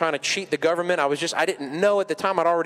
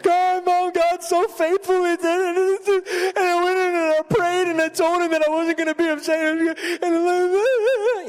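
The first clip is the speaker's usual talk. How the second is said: high, loud tearful thanks, slow at first; crying; 4 dB louder, slower than usual. Retold or imitated. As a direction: sob out the news of God's faithfulness. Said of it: "crying again".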